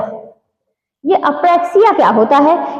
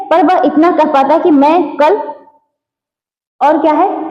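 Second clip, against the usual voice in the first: ordinary delivery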